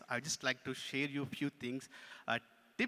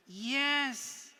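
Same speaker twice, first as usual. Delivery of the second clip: higher-pitched puppet reply; insistent puppet; 10 semitones higher, 6 dB louder than usual. Pretend. Puppet answers its ventriloquist.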